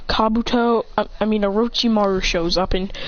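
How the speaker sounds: ordinary delivery, nothing marked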